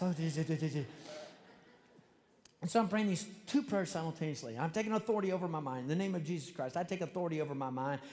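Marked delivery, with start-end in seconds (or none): 0.0-0.9 gabbled name, almost like speaking in tongues; rapid-fire praying. Acted. Demonstrating praying so fast it sounds like tongues, gabbled. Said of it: "You're praying so fast". 4.6-8.0 hurried, urgent prayer of authority; rapid-fire praying. Retold or imitated. Man fearing the devil, praying as fast as he can. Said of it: "as fast as I can in English".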